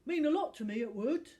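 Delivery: loud wheedling thug; pleading. Acted. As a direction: keep begging. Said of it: "pleaded Nigel".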